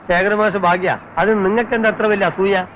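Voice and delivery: a touch of heated retort. Acted. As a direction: bristle at the gossip. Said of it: "അതിന് താനെന്തിനാ ഇങ്ങനെ ചൂടാവണത്?". (ചെറിയ ചൂടോടെ)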